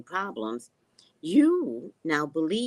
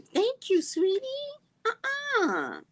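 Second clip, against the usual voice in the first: very high, sugary put-on voice; squeaky mock thanks; mock-sweet dismissal; 5 dB louder, much slower than usual. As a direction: sing it out high with fake sweetness, then refuse. Mock answer to doctors.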